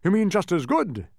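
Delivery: high, loud bug correction; correcting. A narrator's reading. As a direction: put him right brightly. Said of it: "corrected the humbug".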